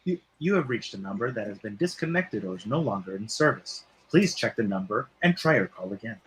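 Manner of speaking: low, even recorded-operator read; lightly imitated phone operator; recorded phone message. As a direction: sound like the disconnected-number recording.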